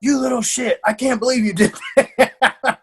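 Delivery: high-pitched mock scolding through laughter; amused disbelief. Coach hearing of the secret chocolate binge, laughingly incredulous. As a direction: scold her while starting to laugh. Retold reaction to chocolate confession.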